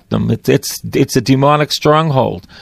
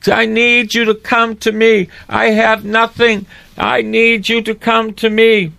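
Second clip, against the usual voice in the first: high, slow pleading call to Jesus; desperate prayer; 6 semitones higher, slower than usual. Acted. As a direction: plead as if you have nothing left. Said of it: "a voice to call out to Jesus with desperation".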